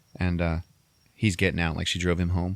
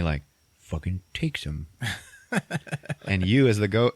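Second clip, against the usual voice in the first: normal talking voice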